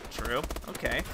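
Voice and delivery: ordinary talk, nothing marked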